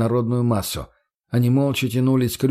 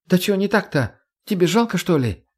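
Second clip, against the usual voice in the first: high indignant whine; 8 semitones higher than usual. A narrator's reading. Margo defends herself.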